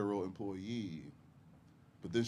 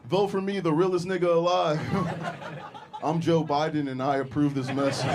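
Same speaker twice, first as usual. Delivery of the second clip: louder, higher hype-man ad voice; mock campaign ad; 5 semitones higher, 6 dB louder than usual. Comedian spoofs Biden ad, loud brash hype.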